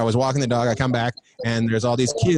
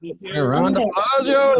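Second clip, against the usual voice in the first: drawn-out greeting; 7 semitones higher, much slower than usual. Real, not acted. Greeting guest on screen.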